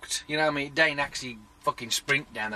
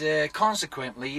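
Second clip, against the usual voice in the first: normal talking voice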